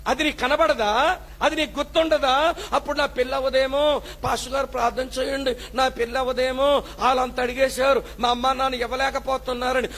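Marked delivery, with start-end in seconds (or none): none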